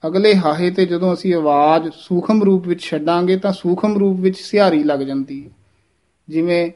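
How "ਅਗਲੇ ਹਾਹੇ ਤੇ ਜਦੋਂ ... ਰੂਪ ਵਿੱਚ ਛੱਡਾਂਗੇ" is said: ordinary talk, nothing marked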